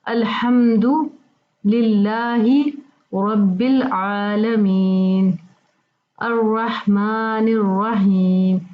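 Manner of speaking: very slow, drawn-out Quranic recitation. Shown as a demonstration. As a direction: stretch each word for the students. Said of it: "बीच में उर्दू तलफ़ुज़ है". (धीरे धीरे खींच कर तिलावत)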